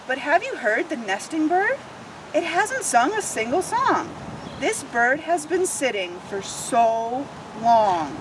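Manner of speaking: lively picture book read-aloud; expressive children's story narration. Acted. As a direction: read it like storytime for little ones.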